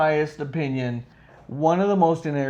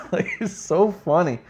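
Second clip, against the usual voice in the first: ordinary delivery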